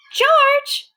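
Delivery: loud, high call for George; Bill calling for the missing monkey. Acted. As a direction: shout his name across the lake. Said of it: "he called".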